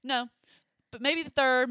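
ordinary delivery

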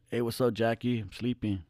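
soft, drowsy mumble just woken; sleepy voice at 1 am; groggy, half-asleep. Retold or imitated. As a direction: answer the phone half asleep. Woken by late phone call.

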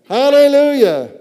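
loud, high hallelujah holler; exultant high-pitched church shout; shouted praise. Real, not acted. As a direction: cry it out to the congregation. Preacher echoes the shouted praise.

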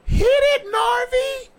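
high, loud mock yell of a woman. Imitating a woman on the tape, yelling out. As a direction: shriek it out far above your own pitch. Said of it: "a bitch just yells out".

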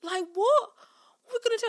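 high, loud, distraught plea; mock heartbroken student. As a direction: wail it helplessly as an abandoned pupil. Tom, you look heartbroken.

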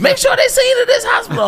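very high, loud mock screech; frantic mom. Mother orders injured son to a hospital, frantic screech. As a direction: screech the order in hammed-up panic. Mom hears of his accident.

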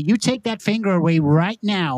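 high, indignant mock-American order; hammed-up American confrontation; American accent. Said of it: "if we switch over to America".

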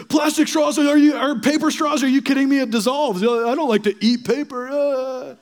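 mock outrage. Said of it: "on the flip side".

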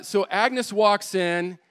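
usual speaking voice

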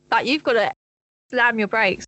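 usual speaking voice

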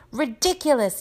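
high, contained yell; Dean. A narrator's reading. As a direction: yell the spell without much extra volume. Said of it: "yelled Dean".

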